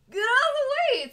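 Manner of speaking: high, loud, fast shout of warning; yelling. Retold or imitated. Speeding down, she screams at a girl blocking the bottom. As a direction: yell it as you hurtle downhill.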